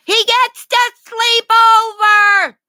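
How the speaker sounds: high, loud, slow sing-song; excited dog-talk. Real, not acted. Owner telling dogs happy news, high sing-song.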